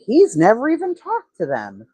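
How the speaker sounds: high, indignant mock outburst; imitating angry Ziggy. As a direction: squeak it out like an outraged gossip. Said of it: "She's angry. She's upset".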